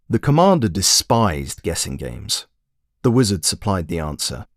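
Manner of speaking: ordinary delivery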